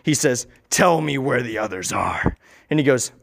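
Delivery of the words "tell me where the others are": low, slow demand; deep, deliberate inner-voice command; deep-voiced brain. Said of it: "The brain has a deep voice".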